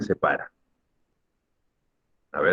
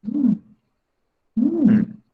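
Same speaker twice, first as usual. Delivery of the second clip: mock disappointed hum. Waiting for volunteers, none.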